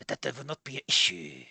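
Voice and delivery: low, quiet mock-Japanese old man; fake Japanese accent. Old man reassures visitor, fake Japanese accent. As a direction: stretch stray vowels onto word endings. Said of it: "did you just double up on the".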